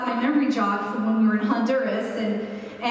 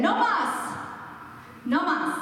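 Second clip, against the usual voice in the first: imitated firm "No mas", pitched up high on the first; emphatic refusal; 6 semitones higher, slower than usual. Retelling a teammate haggling at a Honduras market, emphatic "No mas". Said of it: "have the same emphatic".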